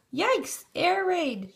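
high startled shout of alarm; panicked high cry. A narrator's reading. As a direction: cry out as the bees dive in. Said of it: "shouted Arnold".

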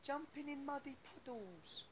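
high, slow sing-song cartoon voice; Peppa Pig sing-song. Fan asks about Peppa Pig, singsong high cartoon voice.

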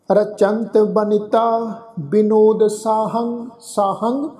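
drawn-out, syllable by syllable scripture reading; slow recitation. Shown as a demonstration. Teacher reciting the hymn slowly for the congregation. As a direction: lay each syllable down slowly and reverently. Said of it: "ਇਹ ਸ਼ਬਦ ਆ ਜੀ".

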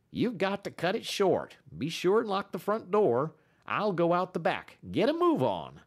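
hurried dog musher. A narrator's reading. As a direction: hustle him out the door. Matt voiced by narrator.

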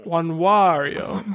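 slow mock Spanish Januario. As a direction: play it like a Spanish word. Joking Spanish-style month name.

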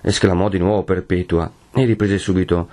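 ordinary delivery

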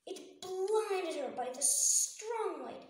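plain reading voice

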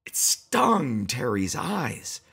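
plain reading voice

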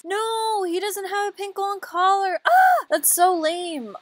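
high wailing protest; dramatic high-pitched whine of dismay; mock outraged whine. Mock outrage at missing collar.

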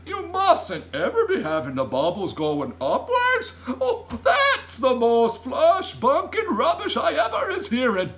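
loud, high, scandalised giant; big indignant giant outburst; giant crying out. A narrator's reading. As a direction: protest as if at utter nonsense. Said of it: "cried the B F G".

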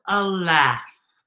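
plain reading voice

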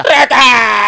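alarido agudo a todo pulmón; grito de alarma. Retold or imitated. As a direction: grita la alerta, alargándola. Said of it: "mi tío cachirulo grita". (grito desgarrado imitando al tío espantado)

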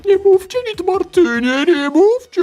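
high squeaky hamster voice. Acted.

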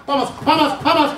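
very high cries for help; acted panic. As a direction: squeal for help while fleeing the dog. Lišák prchá před štěkáním. (panické volání o pomoc)

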